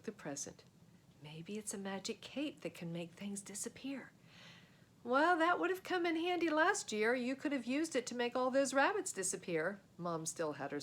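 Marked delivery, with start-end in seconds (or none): 1.2-4.1 hushed, awed whisper. Acted. Boy whispers his wish about the present. 5.0-9.8 high, sharp dry jab; sour sarcasm. Acted. Mom makes a sour jab about the rabbits.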